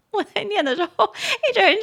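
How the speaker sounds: talking through a laugh. Real, not acted. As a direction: giggle while you speak. Laughing at own accent.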